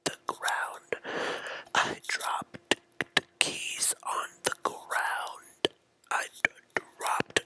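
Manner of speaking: hushed whisper; slow whispered confession. Acted. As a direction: whisper it, breathy and scared. Whispered mock sketch opening.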